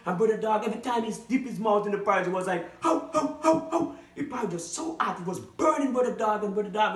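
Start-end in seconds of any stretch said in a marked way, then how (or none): 2.8-3.9 yelping dog. Acted. Dog burns mouth on porridge.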